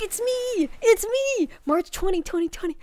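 high, loud delighted cry; excited squeal. Real, not acted. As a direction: squeal it out with glee. Thrilled at the trailer.

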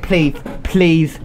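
usual speaking voice

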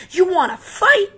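high, loud taunt with a rising end; bully's dare. Acted. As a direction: dare him to fight. Grouchy ladybug picks fight.

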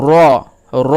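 র said thick with rounded lips; heavy rounded r. Shown as a demonstration. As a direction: make র full-mouthed and round. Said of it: "মোটা করে উচ্চারণটা করতে হবে".

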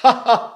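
short, high, loud exercise laugh; laughing technique. Shown as a demonstration. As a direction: fire off a brief burst of "ha"s. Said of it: "and I laugh".

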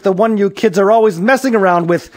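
high, loud telling-off; scolding. A narrator's reading. Police chief blaming meddling kids, scolding.

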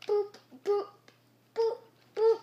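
high, loud little boops; boop noises. Real, not acted. Gamer playing about, high boop noises. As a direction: pop out short high boops.

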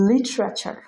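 লিটারেচার with a crisp t sound; standard pronunciation. Shown as a demonstration. Teacher giving the standard form first, লিটারেচার with a clear t. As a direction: say লিটারেচার with a true t, not a d. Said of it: "প্রথম শব্দ standard উচ্চারণ হচ্ছে".

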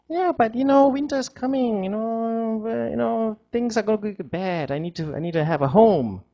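high, pleading little squirrel. Acted. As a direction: beg the tree for a home in a high squeak.

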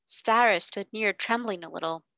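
usual speaking voice

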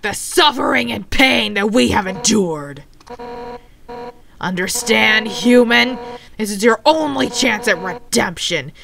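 rough, gravelly, forceful warrior voice; Undyne. Acted. Warrior demanding the human's soul, rough gravelly voice. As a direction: growl out a threatening speech, building to a loud shout. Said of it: "I'm gonna have to do her rough, gravelly voice".

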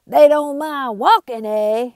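loud, high furious outlaw. Acted. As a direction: rage at the whole town. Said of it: "Bart was furious".

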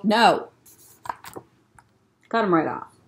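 ordinary delivery

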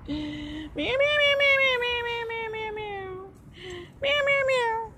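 high mock cat meows; meowing cat. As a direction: meow like a playful cat, up high. Repeated meowing.

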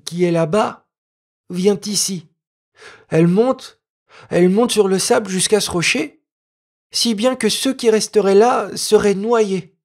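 put-on a village boy patiently explaining the tide; earnest peasant boy. Peasant boy explaining the tide to Noémie, earnest child voice.

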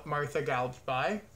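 ordinary delivery

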